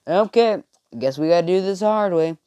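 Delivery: playful threat; mock resignation. Acted threat after refusal.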